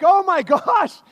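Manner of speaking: high, loud gasping oh my gosh; shocked family. Retold or imitated. Family reacting to the bird alive, shocked high exclaim. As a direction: gasp it in disbelief. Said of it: "the family's like".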